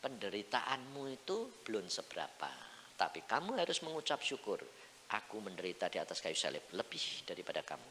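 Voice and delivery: soft, measured divine rebuke; quiet, calm voice of God; suara Tuhan. Acted. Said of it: "Tuhan ini ngomong". (suara Tuhan yang lembut)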